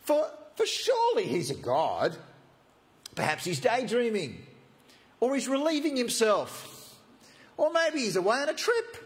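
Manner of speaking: high-pitched sarcastic taunting; mocking. Elijah mocking the prophets of Baal about their silent god. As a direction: tease them with exaggerated, climbing suggestions. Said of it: "Elijah began mocking them".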